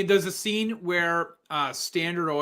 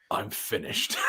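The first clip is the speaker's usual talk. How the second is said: lower, softer, breathy final line; spent Plainview; 8 semitones lower, 7 dB quieter, slower than usual. Retold or imitated. Plainview after the murder, lower and softer, exhausted. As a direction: drop it low like he's done. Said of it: "And he's like".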